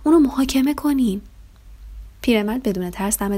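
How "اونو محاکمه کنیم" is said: louder, higher storybook shout. A narrator's reading. Another villager demanding a trial, shouting. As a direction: cry out a demand from the crowd. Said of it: "دیگری فریاد زد".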